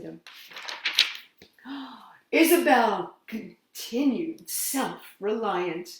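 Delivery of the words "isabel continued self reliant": usual speaking voice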